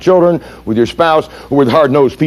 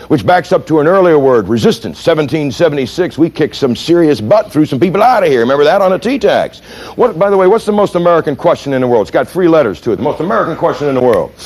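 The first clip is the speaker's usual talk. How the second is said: ordinary talk, nothing marked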